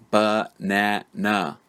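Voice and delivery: "Banana" drawn out slowly in three separate syllables. Shown as a demonstration. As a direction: count out "Banana" as ba, na, na with gaps between. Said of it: "this word has three syllables".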